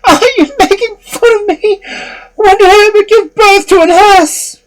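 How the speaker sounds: very high, loud outraged shriek; shrieking mother. Acted. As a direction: shriek it in horror. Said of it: "she shrieked and exclaimed".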